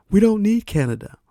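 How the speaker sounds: lightly imitating Trump, scoffing; mocking Trump. Vlogger mocking Trump's line to viewers, lightly imitated.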